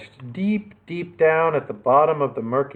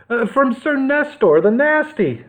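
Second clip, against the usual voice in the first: put-on higher, hurried boy's voice; quick nervous answer; 10 semitones higher than usual. A narrator's reading. Captive boy answers the robber chief, quickly and higher. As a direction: blurt it out to save himself. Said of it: "Nick answered quickly".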